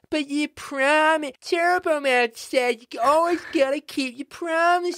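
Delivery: hammed-up little boy pleading; whiny kid voice. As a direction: whine like a let-down boy. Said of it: "five times".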